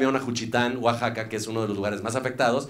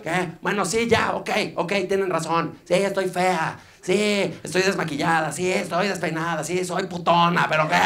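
high, mocking rant; mock angry; 5 semitones higher than usual. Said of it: "súper enojada". (voz aguda y burlona, fingiendo enojo)

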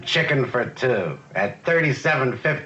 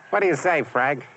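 normal talking voice

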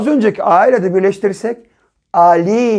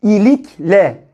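slow, syllable by syllable İlikle; İlikle stretched like a reading drill; slow reading aloud; much slower than usual. Shown as a demonstration. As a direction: read İlikle out slowly for the class to follow. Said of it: "Okuyalım hep birlikte".

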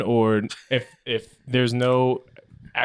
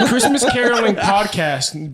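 laughing; 7 semitones higher, 5 dB louder than usual. Real, not acted. Laughing while pitching joke idea.

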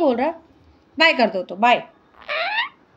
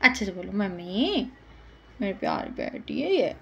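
much softer, lower loving coax; gentle coaxing; 6 semitones lower, 8 dB quieter than usual. Real, not acted. Mother gently coaxes her parrot, soft and tender. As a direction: soothe the bird into trying.

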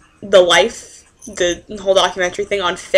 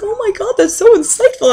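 mock professor; 11 semitones higher, 7 dB louder than usual. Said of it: "she's like".

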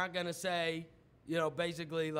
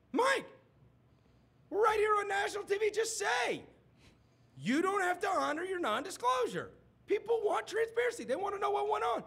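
high, fast hammed-up Biden pressing; Biden impression; 10 semitones higher than usual. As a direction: pester him like an excited old senator. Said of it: "Joe Biden's like".

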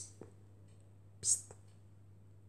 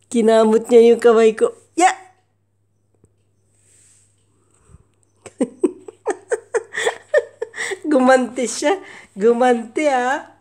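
much louder, amused outcry; loud laughing complaint; 14 dB louder than usual. Real, not acted. Owner loudly laughing that her cat fought back. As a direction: exclaim through laughter.

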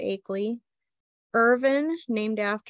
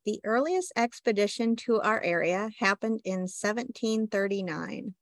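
normal talking voice

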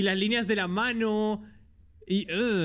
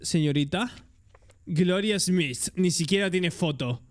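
ordinary talk, nothing marked

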